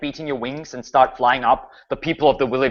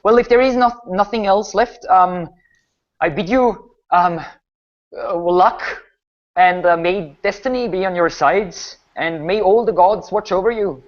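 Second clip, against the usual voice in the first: higher-pitched, halting baron; Baron Nimbin; 7 semitones higher than usual. Acted. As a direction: play a hesitant noble giving a solemn farewell blessing. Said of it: "Baron Nimbin looks at you and says".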